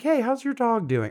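high, light, chatty greeting; friendly small talk. Retold or imitated. Regular customer chatting with staff about their dog, friendly. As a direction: pitch it up for breezy small talk.

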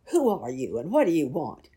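loud gruff challenge at the door. A narrator's reading. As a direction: bark it gruffly through a crack in the door. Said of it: "he said in a very gruff voice".

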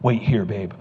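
low, soft take-charge line; heroic husband. Retold or imitated. Husband tells his wife to stay back, heroically calm. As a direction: play the protector, voice dropped low.

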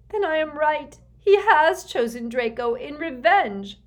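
high, loud, anguished cry; choked. A narrator's reading. Mother realising her son is revenge, choked with grief. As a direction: blurt it out in despair. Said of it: "choked Narcissa".

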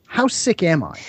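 usual speaking voice

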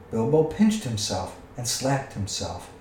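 usual speaking voice

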